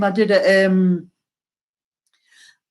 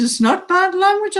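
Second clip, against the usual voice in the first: much higher, retold indignant comeback; indignant protest; 13 semitones higher than usual. Nana answers grandson about her dialect, high indignant protest.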